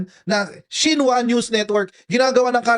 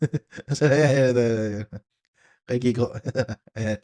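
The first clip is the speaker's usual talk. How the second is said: laughing; 11 semitones lower, 4 dB quieter, much slower than usual. Real, not acted. Streamer laughing at clip.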